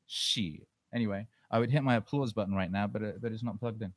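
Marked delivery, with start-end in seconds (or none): none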